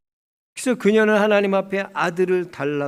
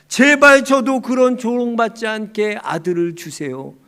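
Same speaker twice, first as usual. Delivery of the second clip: loud, raised lament without real sobbing; acted wailing plea; 4 semitones higher, 9 dB louder than usual. Hannah begging God for a son, loud wailing plea. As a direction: cry out in protest, imitating a desperate prayer. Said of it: "한나는 하나님께 통곡합니다".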